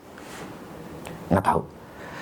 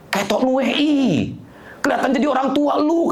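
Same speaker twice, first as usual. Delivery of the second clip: retold critic; 10 semitones higher than usual. A churchgoer criticises the preacher's outfit, retold high-pitched criticism. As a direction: imitate a critic in a much higher voice.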